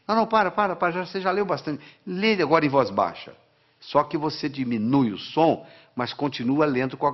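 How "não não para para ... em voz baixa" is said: voz de pai ou mãe, mais aguda; pais interrompendo. Acted. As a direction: imitate a parent cutting in, higher-pitched. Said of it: "os nossos pais dizem assim". (pais mandando parar, em tom agudo)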